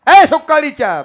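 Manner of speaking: high, loud rustic scolding; Balumama scolding. Acted. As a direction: scold the weeping farmer roughly. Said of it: "बाळूमामाने सांगितलं". (रडणाऱ्या शेतकऱ्याला बाळूमामांचा जोरदार दटावणी आवाज)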